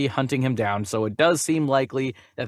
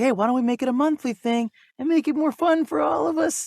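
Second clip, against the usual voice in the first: higher, bright mock pitch; cheery suggestion; 10 semitones higher than usual. Hosts recalling their idea, higher cheery pitch. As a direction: pitch the idea like an eager kid. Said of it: "So we were like".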